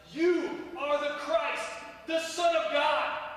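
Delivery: slow, loud proclamation of faith; fervent confession. Retold or imitated. Peter confessing to Jesus, slow and loud. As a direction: declare it slowly with full voice. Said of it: "I got to say to him".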